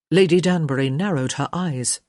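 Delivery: ordinary delivery, nothing marked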